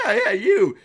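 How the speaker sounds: high, loud eager shout; eager townsfolk. Acted. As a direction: insist it's him, all excited. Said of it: "And they're like".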